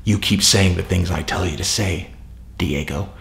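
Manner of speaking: low and soft, a sinister coo; creepy Josh voice. Retold or imitated. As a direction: purr it like a creepy handler to his pet. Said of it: "And Joshua's all".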